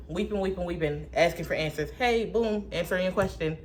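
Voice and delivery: ordinary delivery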